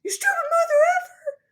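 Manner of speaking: put-on high, loud crying shriek of a ten-year-old kid; shrill tantrum scream, crying; kid yelling. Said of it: "and he just yells".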